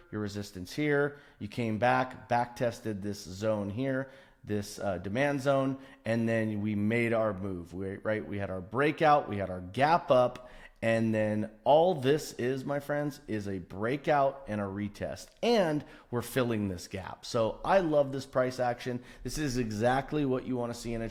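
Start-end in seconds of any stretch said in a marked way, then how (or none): none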